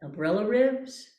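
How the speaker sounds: ordinary talk, nothing marked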